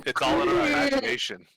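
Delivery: high, sing-song take; high mock voice. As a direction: say it up high, playfully. Repeats line, first take high.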